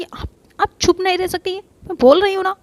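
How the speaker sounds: high, loud snapping retort; angry outburst. Acted. Sarika snapping at Avantika for interrupting, angry and loud.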